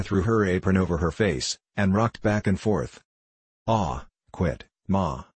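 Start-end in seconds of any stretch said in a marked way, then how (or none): none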